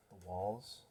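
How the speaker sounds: ordinary delivery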